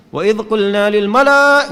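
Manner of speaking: recited loud and high on qulna, wrongly; too-high recitation. Shown as a demonstration. Teacher showing the wrong high way to recite. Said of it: "nggak enak bacanya".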